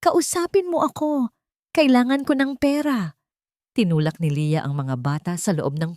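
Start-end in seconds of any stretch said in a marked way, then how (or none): 0.0-3.2 desperate angry shout. A narrator's reading. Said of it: "puno ng galit at desperasyon".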